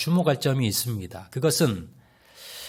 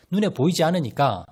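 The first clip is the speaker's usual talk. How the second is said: ordinary talk, nothing marked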